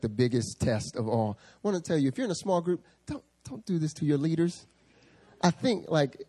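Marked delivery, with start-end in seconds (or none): none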